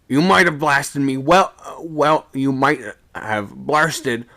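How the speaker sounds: a seaman shouting in anger aloft; angry shout. A narrator's reading. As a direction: yell at the man below. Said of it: "he shouted".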